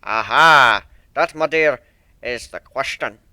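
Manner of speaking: put-on loud, stern old sailor with rolled R's; stern sea dog accent. One-winged Pegasus apologising to Fluttershy, stern thick accent.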